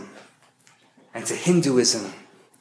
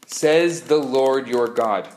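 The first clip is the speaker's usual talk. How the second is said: ordinary talk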